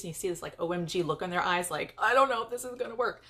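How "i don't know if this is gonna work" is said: raised, hammed-up worried voice; mock fear. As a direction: imitate a nervous blogger hesitating. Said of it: "they show fear".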